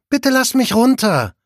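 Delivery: high, loud whiny plea to be put down; whining. A narrator's reading. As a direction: whine like a nauseous girl being carried. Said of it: "quengelt die kleine Miss Bowman".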